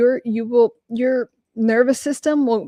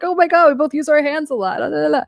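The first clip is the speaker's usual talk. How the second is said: higher, gushing fake delight; mock gushing mirror; 6 semitones higher, faster than usual. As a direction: parody someone copying you eagerly. Mocking a mirroring narcissist.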